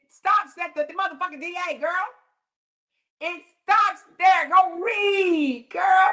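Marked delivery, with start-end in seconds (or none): 3.2-6.1 high, loud, stretched yell; exasperated shout. Real, not acted. Shouting at Donna to go read, high and loud. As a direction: hammer it home, fed up.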